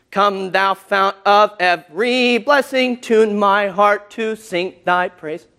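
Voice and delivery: slow, sung-speech "Come Thou Fount" with the stress on the off syllables; awkward mismatched stress. Shown as a demonstration. Teacher demonstrating an awkward hymn fit, slow and misaccented. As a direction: deliberately force the words onto the wrong beats. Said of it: "It's awkward, right?".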